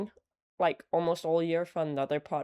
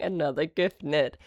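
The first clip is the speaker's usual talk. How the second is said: usual speaking voice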